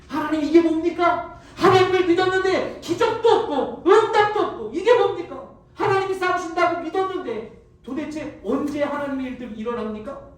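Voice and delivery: anguished complaint. Acted. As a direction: hurl the complaint at God. Moses cries out in disappointment.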